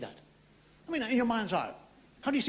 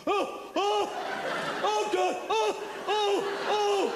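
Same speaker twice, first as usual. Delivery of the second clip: agonized orgasm moans; 12 semitones higher, much slower than usual. Retold or imitated. Mocking lovers who sound like they're in agony, in high pained moans. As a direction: moan as if in agony, hammed up. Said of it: "They sound like they're in bloody agony".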